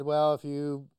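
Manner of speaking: ordinary talk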